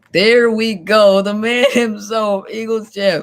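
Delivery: host hyping up the Eagles champ joining the stream; hype intro. Acted. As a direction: announce him like a champ entering the ring. Hyping the guest's arrival.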